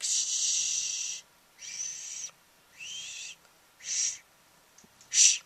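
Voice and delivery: mock shushing. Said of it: "and it's just like".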